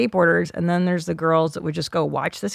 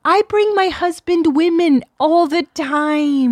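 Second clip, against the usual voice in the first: mocking high voice; 9 semitones higher, 4 dB louder than usual. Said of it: "she's like".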